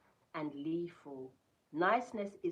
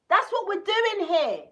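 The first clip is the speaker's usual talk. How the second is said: much higher and louder, declaring it; emphatic shout; 18 dB louder, slower than usual. Real, not acted. Telling viewers their purpose, emphatic shout. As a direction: shout it like a mic drop.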